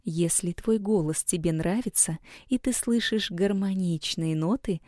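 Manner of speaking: ordinary talk, nothing marked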